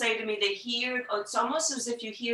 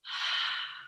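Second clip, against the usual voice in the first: a long breathy whispered haaa out of the throat; Ujjayi exhale demo, throat slightly constricted; whispered HA breath; 6 dB quieter than usual. Shown as a demonstration. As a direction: fog a mirror with a whispered haaa. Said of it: "we're going to whisper the letters H A".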